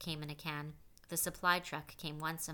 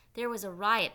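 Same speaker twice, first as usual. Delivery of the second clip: loud report; 5 semitones higher, 10 dB louder than usual. A narrator's reading. Counselor reporting loudly.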